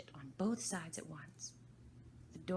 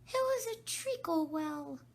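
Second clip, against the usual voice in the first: very high, slow squeak; Dormouse voice; 14 semitones higher, 8 dB louder than usual. Acted. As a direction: draw it out as the Dormouse.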